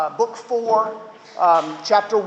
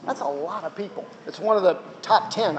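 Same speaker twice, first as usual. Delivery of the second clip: ordinary delivery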